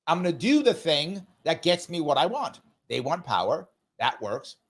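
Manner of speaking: normal talking voice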